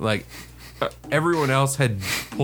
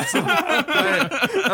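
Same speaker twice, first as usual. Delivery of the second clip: high laughing break; much slower than usual. Real, not acted. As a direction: laugh through it. Laughing hard.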